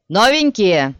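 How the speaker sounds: loud sergeant bellow; shouting drill sergeant. Acted. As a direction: bark at the newcomers. Sergeant greets recruits.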